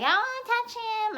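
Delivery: high-pitched mock gushing; put-on high voice; mimicking onlookers. Mimicking excited strangers at airport.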